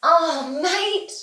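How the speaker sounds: ordinary talk, nothing marked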